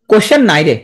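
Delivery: questionnaire turned into কোশ্চেন নাই রে; mnemonic pronunciation. Shown as a demonstration. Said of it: "কোশ্চেন ইয়ার পড়বা কি হবে?". (বানান মনে রাখার উচ্চারণ "কোশ্চেন নাই রে")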